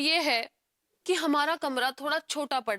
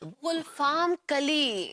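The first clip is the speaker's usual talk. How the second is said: गुलफाम कली stretched out slowly; drawn-out correction; much slower than usual. Acted. Woman correcting man on her name, slow emphatic गुलफाम कली. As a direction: spell out गुलफाम कली with long, deliberate vowels.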